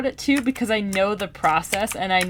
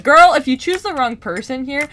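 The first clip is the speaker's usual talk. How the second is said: raised, higher warning voice; exasperated shouting; 5 semitones higher, 6 dB louder than usual. Retold or imitated. Reader warning the heroine about her choice, exasperated. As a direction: scold the character loudly. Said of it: "I was, like, shouting".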